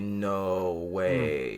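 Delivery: drawn-out, sinking no way; retold disbelief. Coworker reading the news on his phone, disbelieving. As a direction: imitate stunned disbelief, stretching the words.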